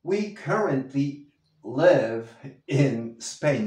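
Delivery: We currently live in Spain, very slow and clear; frase modelo lenta; slow model sentence. Shown as a demonstration. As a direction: read it slowly for repetition. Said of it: "Practícalo".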